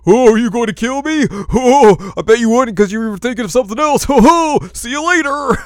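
very high, loud mocking cackle-voice; hammed-up shrieky taunt with "ho ho" laughs; taunting villainess. Villainess mocking the hero before she leaves, high loud taunt.

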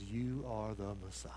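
hushed, sincere confession of faith; near-whispered, earnest declaration. Acted. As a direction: say it with quiet conviction. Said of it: "with all sincerity".

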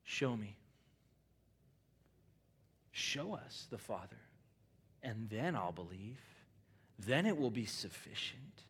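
soft, low doubting request; mock doubting. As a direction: quietly demand proof. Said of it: "we still ask the question".

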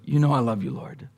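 quiet, low, humbled reply. Acted. Peter answering Jesus by the campfire, low and humbled. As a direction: say it low and broken, pride gone. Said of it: "In a low voice".

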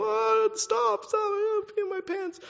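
very high squealing plea through laughter; mock laughing plea. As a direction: beg them to stop, squealing. Mock quote of laughing person.

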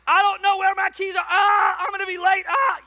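high, loud mock-panic shouting; panicked yelling. Husband who lost his keys before leaving, panicked yelling. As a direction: yell frantically, with screamed "ah"s between. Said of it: "I go into panic mode".

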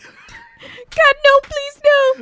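high, loud mock wail; mock crying. Crying husband begging not to have father-in-law, mock wailing. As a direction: plead through hammed-up sobs. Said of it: "'Cause he's still crying".

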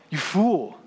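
a firm, final pronouncement; God's stern verdict. Retold or imitated. God condemning the rich man, firm verdict. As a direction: deliver it as a judgement, not an insult. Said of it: "And God says".

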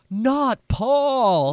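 high, loud shocked cry; mock outrage. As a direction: gasp it out in mock horror. Mock horrified protest.

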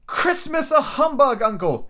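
high-pitched, drawn-out disbelief; slow, high protest from the nephew; Scrooge's cheerful nephew, incredulous. Acted. Nephew disputing his uncle's humbug, high incredulous voice.

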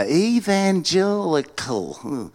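slow, drawn-out "Evangelical", rising at the end; puzzled sounding-out. Acted. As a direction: sound out "Evangelical" as an unknown word. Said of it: "how do you say that?".

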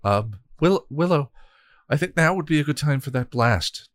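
a touch of nervous urgency; stammering nervous nudge. Acted. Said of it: "Tara said nervously".

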